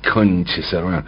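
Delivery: mock exasperated curse. Lecturer illustrating the interjection, mild mock curse. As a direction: toss it off like a fed-up aside. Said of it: "Algo así, ¿no?".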